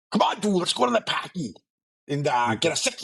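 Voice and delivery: high, hammed-up Boston accent for a party dude; imitating a Boston bro calling his buddy to a party, Boston accent. Showing the host how Boston differs from Maine, hammed-up Boston accent. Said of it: "That's Boston".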